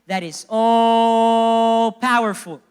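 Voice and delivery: "all" held very long and loud; stress on all. Real, not acted. Preacher exalts Jesus to the church, huge stress on "all". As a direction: stretch "all" into a sustained cry before "powerful".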